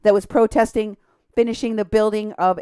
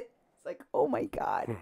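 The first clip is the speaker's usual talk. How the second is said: normal talking voice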